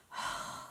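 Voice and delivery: a soft, breathy sigh; acted mother duck sigh; weary sigh. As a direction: let out a tired little sigh. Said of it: "The mother duck sighed".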